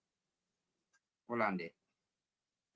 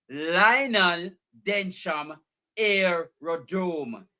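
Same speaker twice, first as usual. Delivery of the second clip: slow reading; 2 semitones higher, much slower than usual. Real, not acted. Reading listing slowly.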